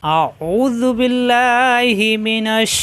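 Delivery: very slow, drawn-out melodic recitation; Quranic recitation. Acted. Teacher opening the lesson for viewers with slow melodic recitation. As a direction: intone each phrase long and sustained, like tilawat.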